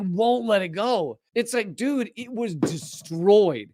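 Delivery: normal talking voice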